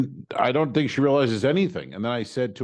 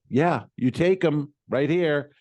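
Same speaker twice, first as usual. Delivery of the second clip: slow, higher, mildly exasperated retort; annoyed; 6 semitones higher, 3 dB louder, slower than usual. Retold or imitated. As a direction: spell it out to him with retold irritation. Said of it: "now I'm annoyed from the Uber thing".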